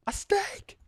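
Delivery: very high, breathy squeal of joy; a steak, shrieked in delight; excited squeal. Real, not acted.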